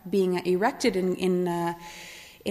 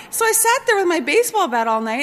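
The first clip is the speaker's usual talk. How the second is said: high, louder, laughing through the words; 10 semitones higher, 6 dB louder than usual. Real, not acted. Storyteller amused by her night guarding the barricade, laughing. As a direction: tell it through your own laughter.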